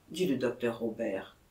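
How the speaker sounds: plain reading voice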